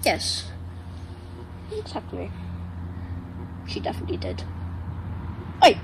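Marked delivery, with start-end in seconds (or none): none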